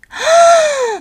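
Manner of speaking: light up at the news; girl exclaiming with shining eyes; delighted cry. A narrator's reading. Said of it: "s'écrie Annie les yeux brillants".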